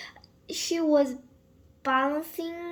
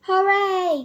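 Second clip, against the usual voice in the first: loud, high hooray from the crowd; a big joyful cheer; 6 semitones higher, 12 dB louder than usual. A narrator's reading. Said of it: "cheered the crowd".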